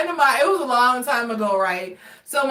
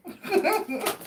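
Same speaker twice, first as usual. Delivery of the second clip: high soft laugh; 8 semitones higher, 5 dB quieter than usual. Real, not acted. After sarcastic quote.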